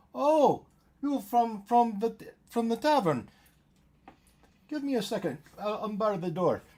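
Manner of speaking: high, hurried welcome; high woman voice. Acted.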